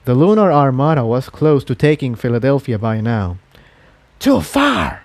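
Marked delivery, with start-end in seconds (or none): 4.2-5.0 hissed. A narrator's reading. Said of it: "it hissed".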